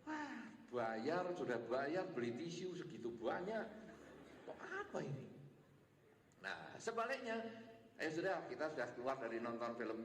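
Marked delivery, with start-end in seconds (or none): 0.1-5.2 a light grumble, half to himself; husband grumbling about the tissues he bought; grumbling spouse. Acted. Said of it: "Satunya ngomel-ngomel".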